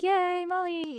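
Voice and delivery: put-on high little-girl cheer; mock girly cheer. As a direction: squeal it like a cheering kid. Girls cheering for Molly.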